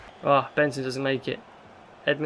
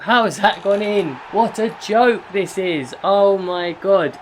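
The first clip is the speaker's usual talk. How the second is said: louder, higher, drawn-out disbelief at the goal; exasperated outburst; 5 semitones higher, 5 dB louder, slower than usual. Real, not acted. Manager watching his team concede, furious disbelief. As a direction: vent at the conceded goal, stretching oh my god.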